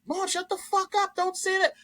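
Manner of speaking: high-pitched imitation of a daughter snapping at her mother; girlfriend yelling at mom. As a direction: squeal it as the annoyed girlfriend. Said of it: "She would be like".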